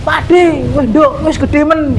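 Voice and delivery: high, bright delighted uncle greeting. Acted. Uncle delighted to see his friend's grown daughter, high and excited.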